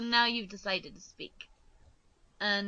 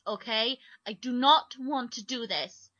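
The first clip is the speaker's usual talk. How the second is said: usual speaking voice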